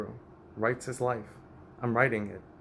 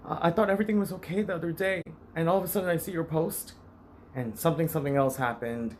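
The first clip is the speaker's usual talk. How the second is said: quoting his mother, higher and fast; mother on the phone; 6 semitones higher, faster than usual. Retold or imitated. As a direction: imitate the worried mother calling, quick and high. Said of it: "she called me and says".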